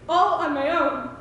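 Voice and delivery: normal talking voice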